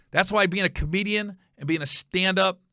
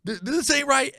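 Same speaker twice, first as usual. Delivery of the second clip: high mock rant; angry stepdad rant; 12 semitones higher than usual. Stepdad berates Gary over chores, high mock rant. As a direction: rip into him in a hammed-up high voice.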